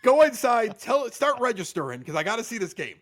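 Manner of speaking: loud, rushed orders to get out; impatient yelling. Retold or imitated. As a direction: bark at her to get out of the car. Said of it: "I was like".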